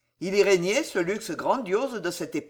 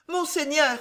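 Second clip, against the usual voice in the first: voix tremblante; 8 semitones higher than usual. Acted. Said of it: "d'une voix tremblante".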